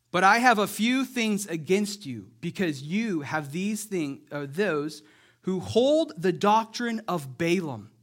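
preacher reading scripture with weight. Acted. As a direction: proclaim the verse to the congregation. Reads Revelation aloud.

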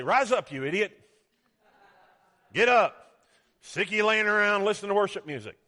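loud, high, fed-up mock order; hammed-up berating of a lazybones; mock scolding. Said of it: "He doesn't say".

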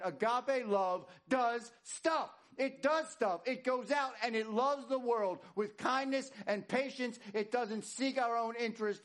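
fervent sermon voice, pressing each point; preaching. Acted. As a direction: drive the point home to the congregation. Preaching builds on agape love.

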